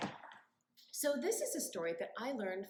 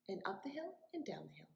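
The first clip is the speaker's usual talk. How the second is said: whisper; 3 semitones lower, 8 dB quieter, faster than usual. Acted. Whispered walking rhythm.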